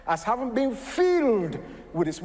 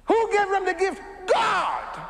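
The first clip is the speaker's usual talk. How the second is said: preaching shout; 14 semitones higher, slower than usual. Real, not acted. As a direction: shout it out to the whole church. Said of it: "I'm sorry I'm talking like this".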